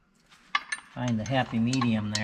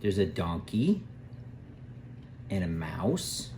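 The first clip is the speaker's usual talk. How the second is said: put-on reading to a toddler; 2 semitones lower, 5 dB quieter than usual. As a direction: read it like a bedtime picture book. Reads the farm book aloud.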